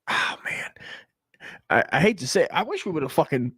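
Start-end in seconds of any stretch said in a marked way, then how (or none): 0.1-0.7 whispered dread. Real, not acted. Dread at nuclear war.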